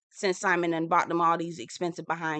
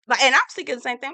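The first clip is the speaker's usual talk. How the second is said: usual speaking voice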